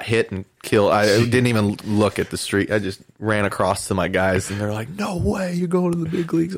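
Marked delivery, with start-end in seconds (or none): 4.9-6.6 high-pitched, thrilled disbelief; amazed teammates. Retold or imitated. Teammates hearing of his call-up, imitated amazed excitement.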